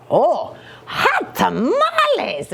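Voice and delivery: high, slow amazed exclamation; exclaiming. Acted. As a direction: shout your surprise at the mirror. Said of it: "exclaimed Skippy John Jones".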